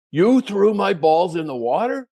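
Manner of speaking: slow, loud, high accusing question; imitated angry disbelief. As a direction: play the tough golfer, outraged and incredulous. Angry golfer accusing him, disbelieving.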